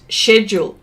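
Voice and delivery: British SH at the start of "schedule"; "schedule" as SHED-yool, like the narrator. Retold or imitated. Host quoting an audiobook narrator, schedule with SH. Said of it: "the narrator said the word".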